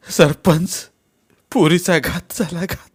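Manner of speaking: high, anguished plea; acted tearful wail. A narrator's reading. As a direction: break the terrible news through tears. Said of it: "रडणाऱ्या शंकरला". (रडत, हंबरडा फोडत)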